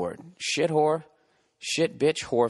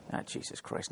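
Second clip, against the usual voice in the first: whispered dismay; 14 dB quieter than usual. Real, not acted. Dismayed at the definition.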